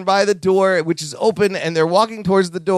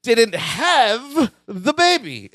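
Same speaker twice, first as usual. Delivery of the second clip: slow, deliberate mock exasperation; frustrated; 2 semitones higher, slower than usual. [name] correcting his congratulating friends, frustrated. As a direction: spell it out slowly, as if fed up. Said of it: "He's frustrated".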